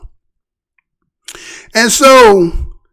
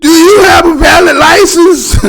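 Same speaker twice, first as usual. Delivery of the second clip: higher, louder, laughing mock of the officer; 7 semitones higher, 3 dB louder than usual. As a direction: mimic her while cracking up. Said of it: "Did you hear how she crossed over".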